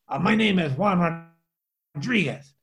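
imitated Spanish accent, introducing himself; thick Spanish accent. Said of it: "with a really thick, uh, Spanish accent".

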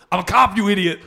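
high, loud Austrian action-star outburst; Arnold Schwarzenegger. Retold or imitated. Soundboard clip yelled at a confused callee, loud Austrian accent. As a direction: bellow it in a comic Austrian accent. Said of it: "the other sound clip was".

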